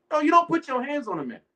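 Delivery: ordinary talk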